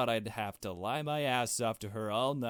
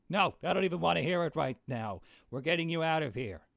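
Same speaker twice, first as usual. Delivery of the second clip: hurried rescuer insisting; urgent; 6 semitones higher, faster than usual. Acted. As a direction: cut them off and take charge.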